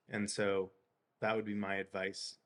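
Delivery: ordinary delivery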